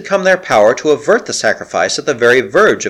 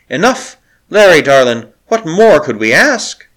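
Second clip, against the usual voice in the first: puzzled; 5 semitones higher, 5 dB louder than usual. Acted. Puzzled bride answers Larry.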